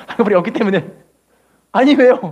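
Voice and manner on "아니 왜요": louder bewildered ask; surprised question. Retold or imitated. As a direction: blurt out the why in surprise. Singer asking director why.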